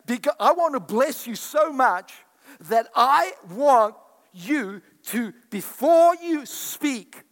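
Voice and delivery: loud, ringing sermon voice; fiery pulpit preaching; preaching at full force. Acted. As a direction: preach it out to the whole church.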